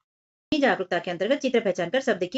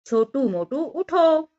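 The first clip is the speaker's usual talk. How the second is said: higher, drawn-out wake-up call; a mildly raised, high motherly call; 5 semitones higher, 4 dB louder, much slower than usual. A narrator's reading. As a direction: slowly call out to rouse sleeping children. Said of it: "माँ ने जोर से पुकारा".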